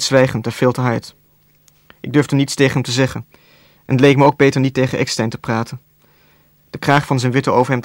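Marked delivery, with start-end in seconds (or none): none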